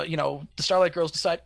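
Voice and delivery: normal talking voice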